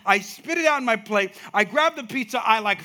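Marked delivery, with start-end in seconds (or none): none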